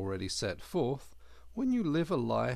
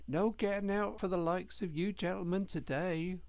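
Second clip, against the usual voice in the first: higher, humble little hedgehog; earnest servant boy voice, higher; young hedgehog voice; 8 semitones higher than usual. A narrator's reading. Hedgehog keeps speaking.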